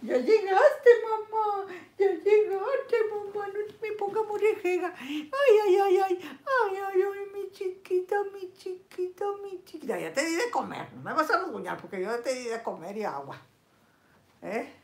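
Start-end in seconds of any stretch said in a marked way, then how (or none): 0.0-10.0 much higher wailing pet talk with mock sobs; high whimpering baby voice greeting her kitty; mock crying to pet. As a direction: cry out to the cat like a baby. Greets her cat in mock tears.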